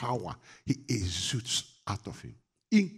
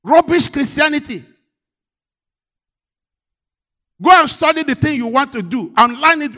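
loud and high, rebuking the congregation; fiery preacher scolding his flock; full-force preaching; 6 semitones higher, 9 dB louder than usual. Acted. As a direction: thunder the rebuke from the pulpit.

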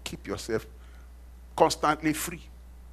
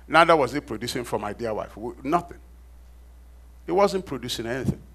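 ordinary talk, nothing marked

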